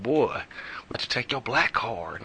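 unvoiced, slowed whisper; whispered jab. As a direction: tease him in a hushed aside. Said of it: "my homeboys back home in K C be like".

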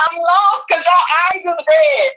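high, drawn-out confusion; slow, high-pitched bafflement, acted out; bewildered. Mother baffled by daughter and lady's red eyes, bewildered.

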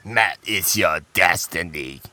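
low raspy Sith whisper; Emperor whisper. Pretend. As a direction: hiss it like a dark lord. Mock Emperor whisper to Matt.

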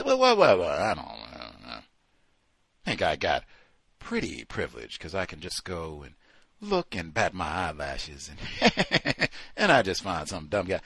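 mock coy answer with a giggle; coy airhead. Imitating her answer about privilege, coy and giggly.

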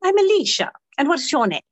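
high, bold British lady; hammed-up British pub flirt; London accent. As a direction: introduce yourself high and loud in a London accent. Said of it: "I'll do the London accent".

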